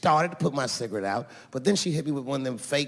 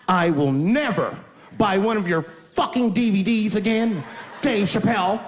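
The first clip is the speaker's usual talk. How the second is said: imitating an outraged woman, higher and louder; angry parting shot; 5 semitones higher, 3 dB louder than usual. Offended wife to comedian on her way out, angry.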